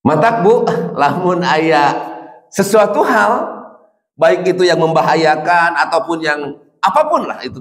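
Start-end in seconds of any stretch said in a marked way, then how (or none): none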